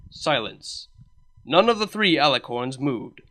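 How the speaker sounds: plain reading voice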